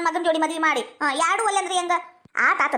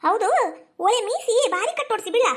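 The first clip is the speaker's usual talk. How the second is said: higher-pitched, needling exclamation; incredulous ribbing; 5 semitones higher than usual. Acted. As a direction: pick at the son's new look. Mother challenges son's moustache style.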